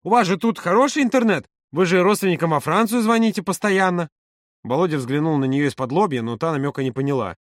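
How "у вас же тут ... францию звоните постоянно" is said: high, insistent chatter; eager persuasion. A narrator's reading. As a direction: talk them into it, pitch raised. Маша eagerly pushes her idea. (увлечённо уговаривая, высоким голосом)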